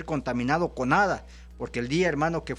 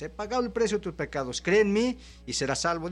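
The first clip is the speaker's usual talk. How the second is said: fervent call; 6 semitones higher, faster than usual. Acted. Preaching Jesus' call loudly.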